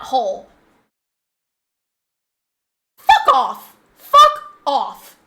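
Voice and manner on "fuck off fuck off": screamed high and loud, real rage; furious shout. Real, not acted. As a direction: yell it right from the gut at them. Furious rant at men.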